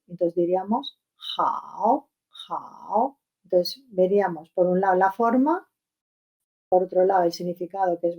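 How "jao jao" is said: drawn-out JAO, vowel lengthened; third tone. Shown as a demonstration. Teacher showing a Chinese third tone, "jao" drawn out. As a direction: stretch the vowel in "jao" slowly. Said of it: "como si fuera alargado, alargando la vocal".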